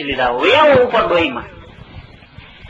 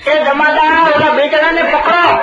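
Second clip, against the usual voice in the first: shouting for the constable; 9 semitones higher, slower than usual. Retold or imitated. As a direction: bellow for the jamadar to grab them. Magistrate yells for the constable.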